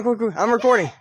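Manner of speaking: normal talking voice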